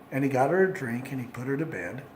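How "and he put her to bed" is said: ordinary delivery, nothing marked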